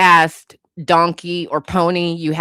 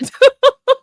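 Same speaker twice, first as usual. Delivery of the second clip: a sudden squealing laugh, much higher; high burst of laughter; 6 dB louder than usual. Real, not acted. Streamer bursting out laughing at the photo. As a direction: crack up at the photo.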